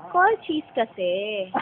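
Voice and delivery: ordinary talk, nothing marked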